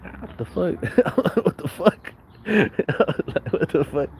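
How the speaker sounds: high-pitched words breaking up into laughter; laughing disbelief. Retold or imitated. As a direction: laugh through your own retold reaction. Laughs while retelling own reaction.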